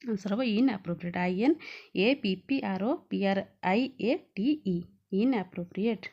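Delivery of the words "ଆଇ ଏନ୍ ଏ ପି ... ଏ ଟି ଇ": spelled letter by letter; spelling out. Shown as a demonstration. Teacher spelling the answer for the class, letter by letter. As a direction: spell it out one letter at a time.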